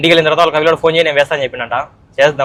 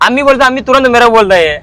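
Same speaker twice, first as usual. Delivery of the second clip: higher, louder, hammed-up Hindi whine about money; mock Hindi speaker; 4 semitones higher, 4 dB louder than usual. Retelling to a friend how brother dodges paying, mock Hindi rant. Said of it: "ఒక ఆరు పోయి".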